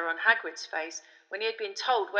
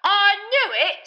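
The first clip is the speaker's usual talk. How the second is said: much higher, louder, slow triumphant cry; gleeful shout; 13 semitones higher, 13 dB louder, slower than usual. A narrator's reading. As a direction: crow with delight. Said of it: "Stan shouted gleefully".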